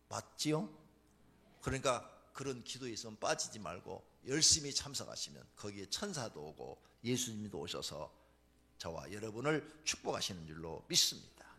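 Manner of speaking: normal talking voice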